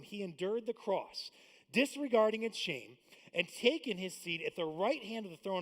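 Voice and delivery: measured, solemn reading of the verses; steady, reverent Bible reading; scripture reading. Acted. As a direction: read the passage like Sunday scripture.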